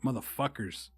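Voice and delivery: normal talking voice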